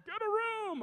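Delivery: high, loud yell from a passing car; heckling driver. Retold or imitated. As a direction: holler it like a mocking motorist. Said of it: "People honking".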